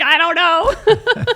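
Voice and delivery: high, loud, drawn-out mock wail; comically exaggerated retold sobbing cry; mock bawling. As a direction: wail it slowly, on the edge of laughter. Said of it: "And I'm just bawling".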